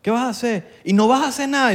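lightly voiced friend nagging about the birthday; mock pushy friend. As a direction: needle him about his birthday plans. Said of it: "por la presión social".

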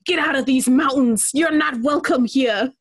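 cranky hermit witch with cackles; old mountain witch. Pretend. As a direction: shoo them off her land. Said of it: "the old witch of the mountains".

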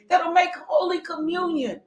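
normal talking voice

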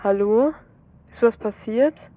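just woken, unsure what's wrong; sleepy and puzzled. A narrator's reading. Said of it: "hebt schläfrig und verwundert ab". (leicht verschlafen und verwundert)

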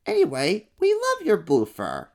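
high, curious little monster voice; an eager small kid-monster. Acted. First little monster asks about the fur color.

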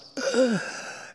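high, sharp gasp for air; desperate gasp. Shown as a demonstration. Preacher shows Jesus struggling to breathe on the cross, desperate gasp. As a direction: gasp like a man who can't breathe. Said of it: "as he's trying to breathe".

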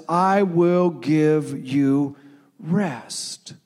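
slow, drawn-out reassuring promise; reassuring preacher, very slowly. Acted. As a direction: linger on each word like a comfort. Quoting the Bible's promise.

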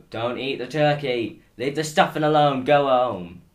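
mock police shout. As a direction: bark orders like a jobsworth copper breaking up Christmas. Policeman voiced ordering people home.

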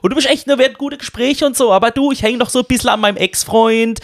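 high, chatty Swabian woman imitation; mimicked Swabian brush-off; Swabian accent. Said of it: "wie sich das anhört auf Schwäbisch".

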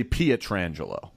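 Pietrangelo as pee-uh-TRAN-juh-lo; alternative pronunciation. Shown as a demonstration. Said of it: "You could possibly say".

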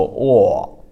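"o" as loud, throaty open aw; froggy pinyin O. Shown as a demonstration. Teacher exaggerating for learners, "o" as froggy aw. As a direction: add frogginess to an unrounded "o". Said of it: "add some extra frogginess".

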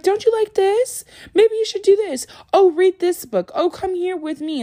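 very high, wheedling singsong; mock coaxing partner. Imitating a partner trying to change someone, high and wheedling. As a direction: coax them in a hammed-up high voice.

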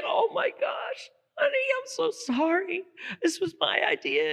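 high, airy, horrified apology; distressed bride. Acted. Bride apologizes after groom's fall.

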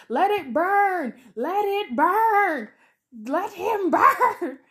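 high, drawn-out mock scream, laugh breaking in at the end; hammed-up scream, stretching the words out high; Laurie screaming. Podcaster imitating the heroine screaming from the truck, mock scream. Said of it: "Laurie's screaming from the truck".

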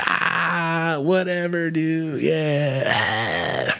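high mocking jeer, sinking at the end; tongue-out taunting. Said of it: "I'm sticking my tongue out".

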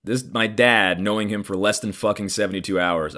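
ordinary talk

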